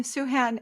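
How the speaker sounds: "Suhan" said slowly like Sue Ann with an H sounded in the middle; careful name demo. Shown as a demonstration. Guest teaching host her name: "Suhan" as Sue Ann with H. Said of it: "It's like Sue Ann with an H in the middle".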